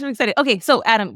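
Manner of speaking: ordinary delivery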